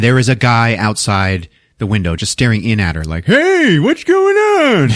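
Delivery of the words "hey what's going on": very high, drawn-out holler; goofy loud greeting. Retold or imitated. Stranger staring in the window at her, hammed-up holler. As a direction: yell it long and high, hammed up.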